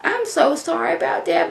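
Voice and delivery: high, soft, syrupy fake pity; fake sympathy. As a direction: coo it sweetly while twisting the knife. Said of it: "She's like".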